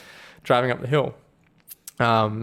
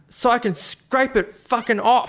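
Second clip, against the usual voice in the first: slow, higher mock gruff demand; hammed-up trucker, slow and pitched up; 11 semitones higher, 4 dB louder, slower than usual. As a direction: drag it out like an irritated truckie. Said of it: "this real gruff trucky guy".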